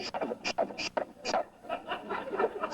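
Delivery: breathless whisper, trailing into a laugh; a whispered command to a racing mind; whispered shut up. Shown as a demonstration. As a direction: hiss it under your breath, half laughing. Inner voice telling mind to stop.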